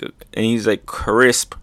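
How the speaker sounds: ordinary talk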